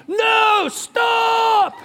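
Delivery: high, loud drawn-out yell; shouting. Retold or imitated. Dad calling after kids running ahead, shouting. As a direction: yell after runaways to halt. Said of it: "I'm shouting".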